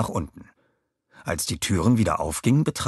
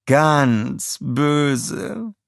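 purring; 7 semitones higher than usual. Acted. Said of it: "säuselte Scarlett".

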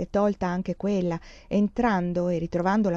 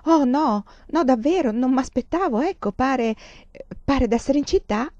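higher and louder, tumbling out in a hurry; rushing to cover her disappointment; flustered reassurance; 6 semitones higher, 5 dB louder than usual. A narrator's reading. Anna to her father who waits for praise, flustered.

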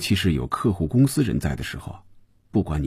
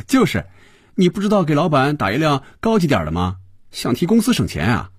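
high-pitched, teasing, opens with a laugh; chuckle, then chide him playfully in a high voice; laughing tease; 7 semitones higher than usual. A narrator's reading. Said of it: "菲比笑着对肖斌说". (笑着调侃，声调偏高)

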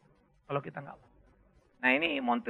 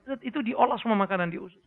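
ordinary talk, nothing marked

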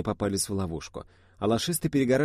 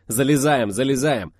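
loud, raised repeated order; urgent hurrying; 4 semitones higher, 6 dB louder than usual. A narrator's reading. Driver hurries the girls into the minibus, shouting. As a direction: shout to hurry them aboard.

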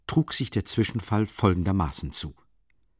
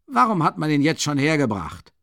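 higher, flustered angry question; angry hiss; 6 semitones higher, 3 dB louder than usual. A narrator's reading. Porfiri complaining to the guards, angry and flustered. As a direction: snap it out, thrown off balance. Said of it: "fauchte ärgerlich Porfiri Petrowitsch".

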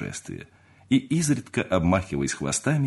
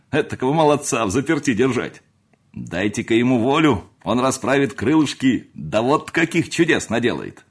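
higher, animated, jovial table talk; bustling, expansive good humour; hearty family friend, cheering; 5 semitones higher, 4 dB louder than usual. Acted. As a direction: cheer everyone up at the farewell meal.

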